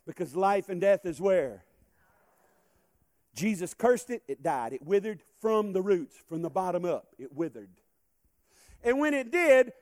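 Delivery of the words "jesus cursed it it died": ordinary delivery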